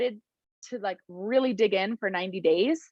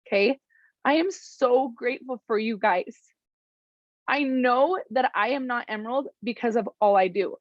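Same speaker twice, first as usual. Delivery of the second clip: usual speaking voice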